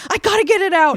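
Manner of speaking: loud, high, laughing-along yell; mock outburst. Woman re-enacting her need to vent, mock outburst. Joins the joking, acted outburst.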